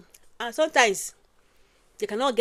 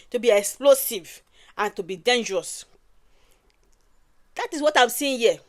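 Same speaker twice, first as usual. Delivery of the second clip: usual speaking voice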